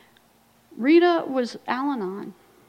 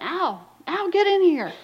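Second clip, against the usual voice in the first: higher, loud call toward the next room; hollering for husband; 7 semitones higher, 7 dB louder, slower than usual. Retold or imitated. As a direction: yell for Al to come in. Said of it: "she hollers for her husband".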